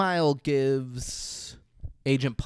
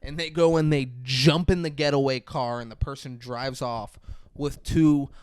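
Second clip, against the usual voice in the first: ordinary delivery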